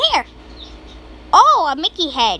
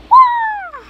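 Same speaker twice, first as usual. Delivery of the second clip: high yelp; 14 semitones higher, 4 dB louder than usual. Real, not acted. Surprise in game.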